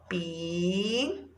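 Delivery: "Pirulito" as pi, ru, li, to, long pauses between; syllable by syllable. Shown as a demonstration. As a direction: softly stretch each syllable of "Pirulito". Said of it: "palavras que nós vamos separar".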